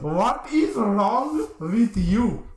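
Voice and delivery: put-on very slow, high-pitched Indian accent; indignant, singsong Indian accent; mock Indian accent. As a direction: drag out each word in a hammed-up Indian accent. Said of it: "this is my natural language".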